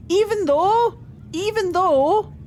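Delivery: put-on a jokey Antivan accent, slow and lifted. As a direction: lean into a hammed-up Antivan lilt. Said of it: "every so often I do my Antivan accent".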